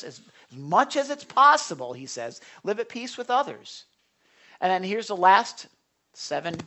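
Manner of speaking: ordinary talk, nothing marked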